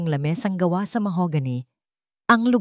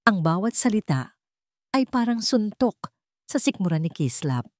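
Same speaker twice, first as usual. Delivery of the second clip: ordinary delivery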